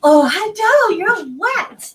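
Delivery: high, loud cooing at the dog; pet talk. Real, not acted. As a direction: gush over the wet dog. Greeting the wet dog.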